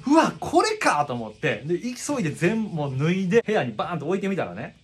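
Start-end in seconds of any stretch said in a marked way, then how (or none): 0.1-1.0 high, loud startled cry; shocked exclamation. Retold or imitated. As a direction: blurt it out in shock. Discovers bugs in jeans.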